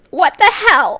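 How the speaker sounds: loud high shouted impression; Fern impression. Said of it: "Is that your impression of Fern?".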